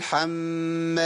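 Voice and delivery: "حم" with a long nasal hold on م; two-count meem. Shown as a demonstration. Teacher showing the two-count hum, slow "حم". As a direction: hum the doubled m of "حم" slowly. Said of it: "أقول لها حركتين".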